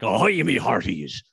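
put-on slow, light pirate accent; a hint of a hammed-up pirate accent. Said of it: "put on a funny accent".

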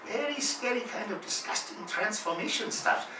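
put-on high Japanese-accented explanation; Japanese accent impression. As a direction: explain it earnestly in broken-English Japanese accent. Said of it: "He mumbles something in Japanese".

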